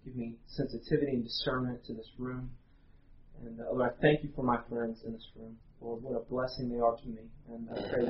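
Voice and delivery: soft, unhurried prayer voice; hushed pastor's prayer before the sermon. Acted. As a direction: pray quietly to God before the room. Pastor leads congregational prayer.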